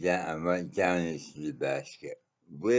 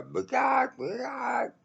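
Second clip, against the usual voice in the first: shrill, very high squawking bird call at full volume; loud bird squawk. Retold or imitated. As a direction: screech it out like a startled bird, as loud as you can. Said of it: "my version of the bird noise".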